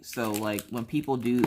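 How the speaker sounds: ordinary talk, nothing marked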